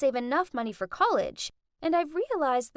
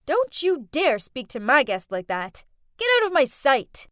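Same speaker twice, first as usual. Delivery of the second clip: louder, sharp order; angry rebuke; 6 dB louder than usual. Acted. Rich girl ordering the maid away, angry. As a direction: throw her out. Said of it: "Jasmine got annoyed and said".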